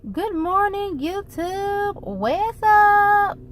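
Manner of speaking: bright, high, upbeat hello to viewers; excited greeting. Real, not acted. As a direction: call out a cheerful good morning.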